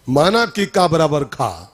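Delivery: slow, spelled-out like an algebra rule; slow formula recital. Shown as a demonstration. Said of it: "algebra formula repeated". (बीजगणित का सूत्र धीरे बोलना)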